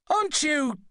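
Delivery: high shout cut off; starting to shout. A narrator's reading. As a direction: shout up to the passenger, breaking off. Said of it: "the doctor started to shout".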